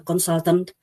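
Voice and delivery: usual speaking voice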